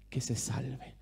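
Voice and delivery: hushed whisper for effect; dramatic stage whisper. Acted. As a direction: drop to a breathy whisper to end the line. Dramatic close of a warning.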